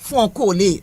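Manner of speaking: "Fon collé" with "Fon" as one nasal vowel; nasal on in Creole. Shown as a demonstration. Teacher confirming the co-host's pronunciation. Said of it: "you have it right".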